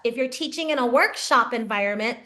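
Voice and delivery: ordinary delivery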